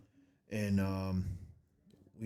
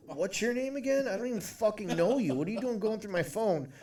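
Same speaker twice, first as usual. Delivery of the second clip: much higher, incredulous retold protest; mock indignant; 11 semitones higher than usual. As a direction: squeak it out like an outraged guy. Indignant retold reply to girl.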